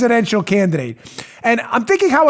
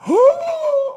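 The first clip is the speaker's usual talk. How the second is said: high, drawn-out shocked gasp; theatrical gasp. Acted. Host plays Rosenstein reading the dossier, mock-shocked gasp. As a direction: suck in a hammed-up breath of shock.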